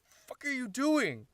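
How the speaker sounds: high, drawn-out outraged yell; choked protest. Acted. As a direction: yell it while being choked. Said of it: "Brant's choking".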